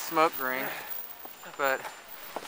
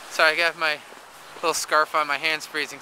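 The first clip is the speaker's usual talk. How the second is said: usual speaking voice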